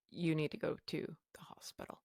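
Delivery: whispered, soft and urgent; a husband whispering down the phone; husband's hushed urging. Retold or imitated. Husband on phone whispering she must go, hushed.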